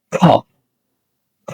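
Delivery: "খ" with a puff of air; isolated, breath-released "খ", demonstrated for learners; aspirated K sound. Shown as a demonstration. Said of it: "এখানে বাতাস আসবে".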